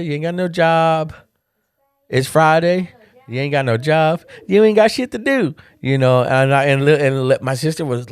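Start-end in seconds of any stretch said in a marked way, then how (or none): 0.0-5.5 Smokey quote. Retold or imitated. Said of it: "what else did he say".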